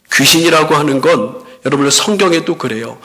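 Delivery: ordinary delivery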